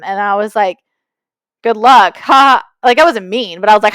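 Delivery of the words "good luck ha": dismissive good luck. Retold or imitated. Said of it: "what the heck was that kind of response".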